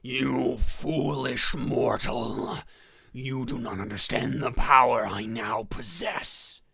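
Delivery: loud, slow villain proclamation; cold rasping evil emperor. Acted. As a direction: boom it like an evil overlord. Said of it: "And he says".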